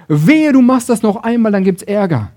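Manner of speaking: loud, high warning with a hard push; stern threat. Acted. The Law warns someone who might do it again, stern threat. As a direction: threaten like the strict Law laying down trouble. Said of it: "Das Gesetz sagt".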